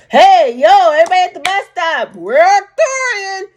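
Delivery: hammed-up shout to everyone at the stop; mock loud announcement. Mock alien calling to bus stop.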